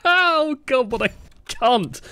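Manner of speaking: high, helpless laughing fit; laughing hard. Real, not acted. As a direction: crack up and barely get the words out. Breaks up laughing at teammate's complaint.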